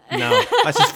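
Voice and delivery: ordinary talk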